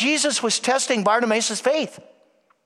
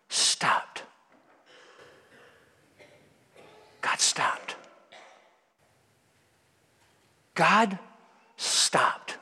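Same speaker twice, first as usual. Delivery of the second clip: hushed whisper, breath only; 5 semitones lower, 6 dB quieter, much slower than usual. Real, not acted. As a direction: drop to a whisper to make them lean in. Whispered repetition.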